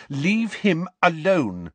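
high, slow, firm order; cold command. A narrator's reading. Lily orders James off Snape, cold and firm. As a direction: order him off coldly, word by word. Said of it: "she said coldly".

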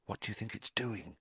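quick, very soft question; hushed. A narrator's reading. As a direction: ask it under your breath, fast. Boys hiding, afraid of being heard.

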